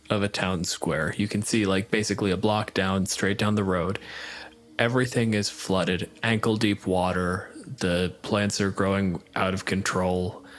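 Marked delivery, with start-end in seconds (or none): none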